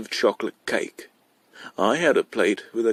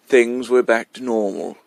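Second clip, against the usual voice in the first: ordinary delivery, nothing marked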